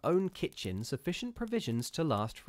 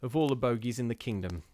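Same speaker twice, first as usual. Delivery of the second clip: plain reading voice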